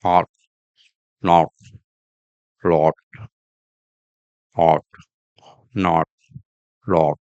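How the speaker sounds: HOT, NOT, LOT with lips in a small circle; low, evenly spaced words with rounded O. Shown as a demonstration. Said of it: "lips forming a small circle".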